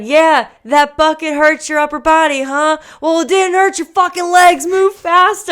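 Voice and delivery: high, loud taunting yell; mock her pain, then bark at her to hurry; yelling coach. Coach yelling at the racer mid-race, taunting. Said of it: "she's yelling at me".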